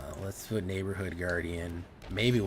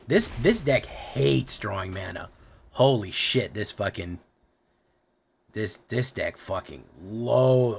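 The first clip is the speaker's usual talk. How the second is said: frustrated outburst; 2 semitones higher, 7 dB louder, slower than usual. Real, not acted. Raging at bad mana draws.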